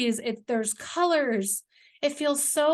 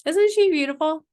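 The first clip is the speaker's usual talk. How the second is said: "Isn't SHE beautiful?" higher and louder with delight; excited; 4 semitones higher, 7 dB louder than usual. Real, not acted. Founder showing off the leopard to viewers, gushing. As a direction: gush over "she" with a bright lift. Repeats admiring question louder.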